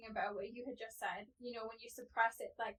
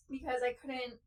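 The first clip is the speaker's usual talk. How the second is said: usual speaking voice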